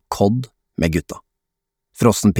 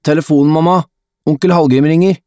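high, raised call; 8 semitones higher, 4 dB louder, faster than usual. A narrator's reading. Adam calls mother to the phone, calling out high. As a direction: shout through the house for someone. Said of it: "roper på mamma igjen".

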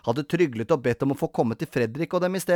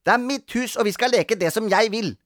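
high, bossy insistence; domineering little girl; grumpy; 9 semitones higher, 4 dB louder than usual. A narrator's reading. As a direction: lay down the law like a cross child. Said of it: "sa gretne Grete".

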